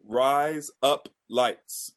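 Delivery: very slow, careful RISE UP LIGHTS; slow, separate words. Shown as a demonstration. Trying the phrase the co-host gave, slowly. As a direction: space out each word of "rise up lights". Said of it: "But say it, like, fast".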